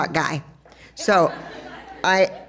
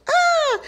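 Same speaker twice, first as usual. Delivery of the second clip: high delighted squeal at the reunion; excited squeal. Retold or imitated. Excited high squeal when she and John Corella meet. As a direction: squeal like spotting an old friend.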